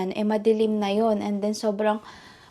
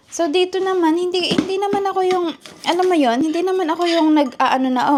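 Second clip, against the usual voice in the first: higher and louder, insistent; heated defence; 7 semitones higher, 4 dB louder, faster than usual. Real, not acted. To her critics, defending her accent, loud and higher. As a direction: push back hard, fired up.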